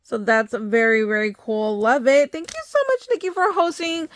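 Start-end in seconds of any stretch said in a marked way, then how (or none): 2.3-4.1 excited thanks. Real, not acted. Thanking the giveaway host.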